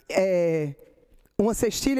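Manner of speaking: ordinary talk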